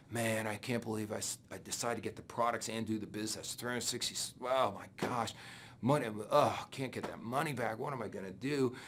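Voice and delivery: mock moping over lost money; gloomy regret. Recruit at work regrets spending money, gloomy soft voice. Showing up at work after crashing.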